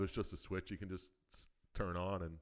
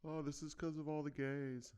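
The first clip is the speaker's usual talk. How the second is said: high put-on pious lady; hammed-up church lady voice; mock zealot woman; 9 semitones higher than usual. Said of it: "that's what she says".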